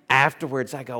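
usual speaking voice

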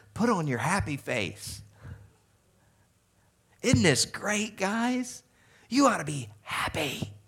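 high-pitched, mock-chipper pep talk; exaggerated cheer. Jesus cheering up disciples in the storm, mock-chipper. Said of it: "And Jesus going".

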